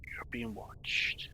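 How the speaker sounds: slow, whispered warning; eerie whisper. Acted. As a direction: breathe it slowly like a hidden watcher. Telepathic voice speaks to him.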